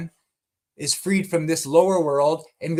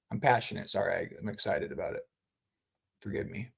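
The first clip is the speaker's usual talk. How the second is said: much softer, sheepish apology; 8 semitones lower, 10 dB quieter, faster than usual. Real, not acted. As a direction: back off quietly and apologize. Apologizing after an outburst.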